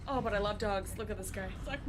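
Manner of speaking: usual speaking voice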